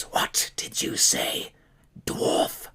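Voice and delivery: low, slow unvoiced whisper of a demonic spider; whispering spider guard. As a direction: breathe the threat at the dwarf. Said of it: "and goes".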